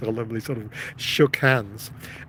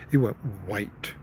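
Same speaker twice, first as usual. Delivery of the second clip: ordinary delivery, nothing marked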